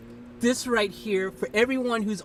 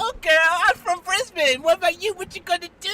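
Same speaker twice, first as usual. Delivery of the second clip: loud, lightly mimicked Aussie accent; mock Aussie accent, excitedly retold; 5 semitones higher, 6 dB louder, faster than usual. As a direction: imitate her Australian accent, higher and louder. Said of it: "then she started talking Aussie".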